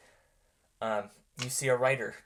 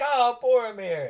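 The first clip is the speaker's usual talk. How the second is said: much higher, louder delighted cry; overjoyed father; 11 semitones higher, 6 dB louder than usual. Acted. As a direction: greet the son with open arms. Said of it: "He spreads his arms and he's like".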